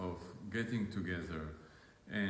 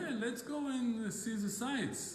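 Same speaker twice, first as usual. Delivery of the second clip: raised, lightly playful invitation to his wife; cheery invitation; 10 semitones higher than usual. Retold or imitated. Husband inviting his beloved, in a lightly raised cheery voice. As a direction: sing it out like coaxing her out.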